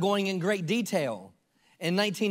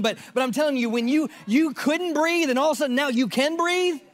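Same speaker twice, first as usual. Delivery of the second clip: higher and louder, building excitement; rising preaching fervor; 6 semitones higher, 3 dB louder than usual. Real, not acted. A preacher telling the congregation of his healing, excited and rising. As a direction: lift it up with joy.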